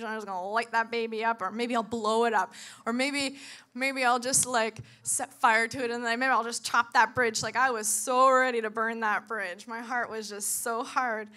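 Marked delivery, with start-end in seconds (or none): 0.0-7.4 louder, relishing each threat; gleeful revenge fantasy. Retold or imitated. Daydreaming about burning her bridge, gleeful and loud. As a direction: build it up with mock glee.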